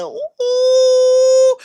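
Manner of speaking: high, loud ooh of shock; a squealed ooh at a burn; mock ooh. Real, not acted. Crowd reaction to the insult.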